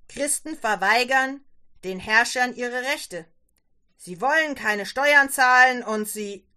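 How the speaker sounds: loud, heated warning; angry tirade. Acted. Father argues against the Christians to daughter, angry.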